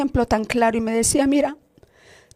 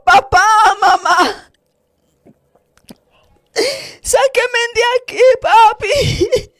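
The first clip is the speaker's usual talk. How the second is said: high voiced children crying out for their parents; 7 semitones higher, slower than usual. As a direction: cry out like a child in the flames. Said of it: "niños allí gritando, llorando".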